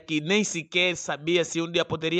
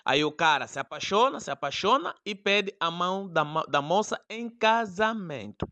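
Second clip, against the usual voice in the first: ordinary delivery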